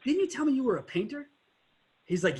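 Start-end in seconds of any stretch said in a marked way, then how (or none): 0.0-1.3 upbeat sales pitch. Retold or imitated. Salesman pitching to angry customer.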